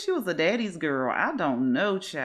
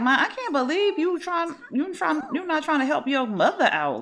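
higher pitched, exasperated scold; a mock-indignant scold, pitched up; scolding daughter; 9 semitones higher than usual. Sydney scolds her mother for not helping, in a higher mock-indignant voice. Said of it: "She like".

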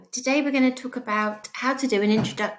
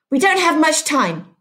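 higher and louder, urgent; urging; 7 semitones higher, 4 dB louder than usual. Acted. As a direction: press him to hurry, higher and louder. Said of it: "urged Catherine".

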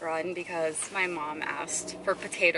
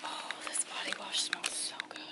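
soft unvoiced whisper; 9 dB quieter than usual. Real, not acted. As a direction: hush it under your breath. Whispering in the store aisle.

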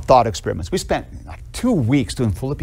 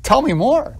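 high, excited mock plea, keen to hear more; eager excitement; 13 semitones higher, slower than usual. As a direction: play the thrilled student begging for more. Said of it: "It's like".

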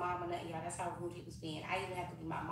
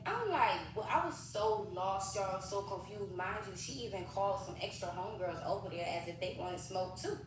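ordinary delivery

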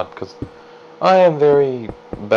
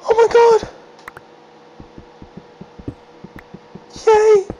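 thrilled. Real, not acted. Finding iron at last, high delighted squeals. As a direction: squeal with joy.

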